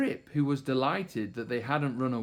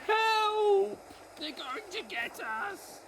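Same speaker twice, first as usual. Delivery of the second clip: panicked cry; slower than usual. A narrator's reading. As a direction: cry for help then shrink back. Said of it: "the other one cried".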